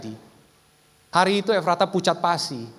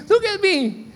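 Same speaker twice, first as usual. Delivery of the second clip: put-on high child's voice, slow and firm; a lightly voiced little girl declaring her faith; brave child; 7 semitones higher, 4 dB louder, much slower than usual. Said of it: "dia bilang gini". (suara anak kecil yang berani, pelan dan mantap)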